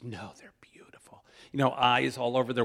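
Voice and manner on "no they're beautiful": a soft reverent whisper; hushed, lightly voiced awe; awed whisper. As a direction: breathe it in wonder. Sister's awed reply.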